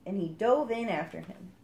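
ordinary delivery

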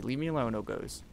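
ordinary talk, nothing marked

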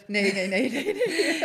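luid lachend nee nee nee; laughing denial. Real, not acted. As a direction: lach het lachend en luider af. Lacht ontkennend over de vraag. (lachend en luider ontkennend)

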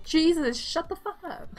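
mock fed-up complaint; exasperated retort. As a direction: snap back at a pestering fairy. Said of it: "I'm like".

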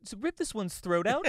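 high, loud mock order; hammed-up cartoon bloodthirst; comic turtle voice. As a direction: give a gory order in a silly high voice. Turtle voice giving gory order.

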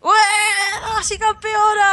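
high shouted cheer. Real, not acted. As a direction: cheer her on like a fan. Cheering teammate kill.

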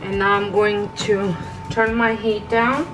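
ordinary talk, nothing marked

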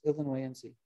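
"Illinoisense" said softly, ending in "see"; sounded final E. Shown as a demonstration. Lecturer showing how a taxonomist sounds, sounded final E. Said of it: "you will sound like a taxonomist".

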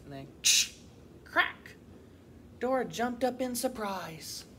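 lively, expressive picture-book reading; animated storybook voice; children's story narration. A narrator's reading. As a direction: read to young children with clear, lilting emphasis. Reads a picture book aloud.

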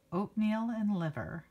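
plain reading voice

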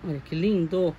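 ordinary talk

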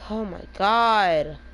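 loud, slow, drawn-out oh my god; exasperated groan. Real, not acted. Streamer groaning loudly at teammate, exasperated. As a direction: groan at the blunder.

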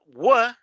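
W as a loud, high "wuh"; teacher's model W sound; isolated W. Shown as a demonstration. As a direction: punch out W as a rounded "wuh".

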